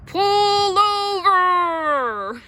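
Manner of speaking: very loud, high, drawn-out call; officer ordering the car to stop; bullhorn shout. A narrator's reading. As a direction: bark it through a megaphone. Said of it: "He shouted into his bullhorn".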